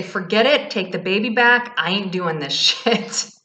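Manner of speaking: a touch of fed-up mother in labour; lightly hammed-up giving up; mock exasperated mother. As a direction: toss it off like a worn-out mom. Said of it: "you'd say".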